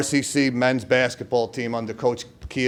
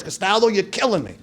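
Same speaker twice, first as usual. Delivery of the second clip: coach's catchphrase; 7 semitones higher than usual. Retold or imitated. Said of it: "all his famous words".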